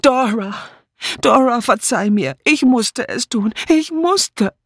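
high-pitched, anguished pleading; desperate cry. A narrator's reading. Murderess begging her dead friend's forgiveness, desperate high cry. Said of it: "Sie schrie".

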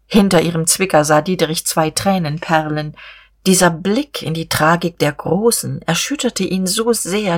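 plain reading voice

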